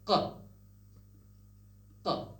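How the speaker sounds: ق as a short uvular stop; qaf sound. Shown as a demonstration. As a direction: pop ق far back in the throat. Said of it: "are the pronunciation of".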